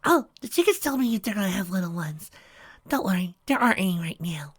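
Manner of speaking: high, gentle pony voice; timid Fluttershy. A narrator's reading. Fluttershy explaining chicks to Trixie, in a gentle high voice.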